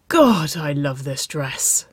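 lightly acted breathless affection; emphatic, with pauses as if catching breath; breathless chuckle. A narrator's reading. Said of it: "Waverley chuckled, breathless and hoarse".

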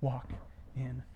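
very slow and soft, fading to a whisper on "darkness"; Jesus's promise, spoken in a hush. Acted. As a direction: let each word hang, then breathe out "darkness". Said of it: "Jesus goes out of his way to say".

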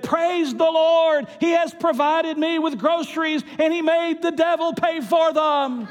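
loud, high-pitched exultant cry; triumphant praise shout. Acted. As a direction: shout the punchline to the heavens. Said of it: "she threw up her hands and she said".

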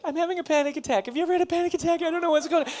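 mock panic. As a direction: rush it like she's panicking. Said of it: "she was like".

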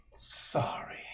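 breathy whispered apology; whispered sorry. Acted. The girl whispers sorry as she leaves the room. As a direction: whisper it sheepishly.

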